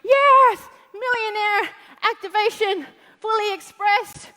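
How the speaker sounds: high, loud, hyped-up event chatter; mock hype. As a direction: gush like an overexcited seminar fan. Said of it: "we're all like".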